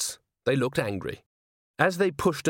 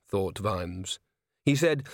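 usual speaking voice

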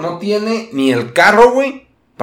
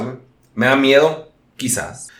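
normal talking voice

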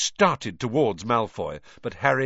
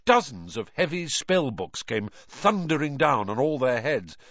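usual speaking voice

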